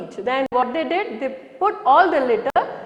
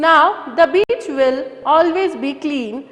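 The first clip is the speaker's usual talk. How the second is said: ordinary talk, nothing marked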